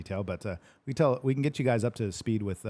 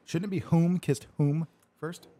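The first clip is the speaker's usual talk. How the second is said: higher, slow, each WHOM drawn out; mock-correct WHOM; 8 semitones higher, 4 dB louder, slower than usual. Host jokingly fixing a viewer's grammar, stressing whom. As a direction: lean on both "whom"s with mock precision.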